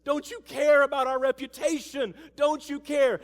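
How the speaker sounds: high, loud, whiny protest; mock the fussing disciples in a strained voice.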